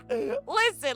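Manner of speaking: high loud laughing listen. Real, not acted. Streamer laughing back at a viewer. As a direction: crack up then answer.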